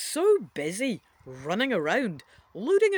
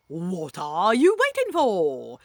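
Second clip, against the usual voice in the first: put-on loud, slow question in a funny accent; Mister Stark; 3 semitones lower, 7 dB louder, slower than usual. A narrator's reading. Stern man hurries the kid, funny accent. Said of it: "Stark asks".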